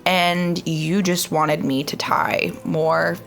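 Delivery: usual speaking voice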